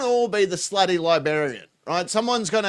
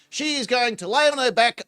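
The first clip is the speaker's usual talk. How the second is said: usual speaking voice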